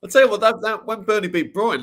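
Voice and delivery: high and loud, still laughing; excited. Real, not acted. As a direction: burst in eagerly. Right after laughing.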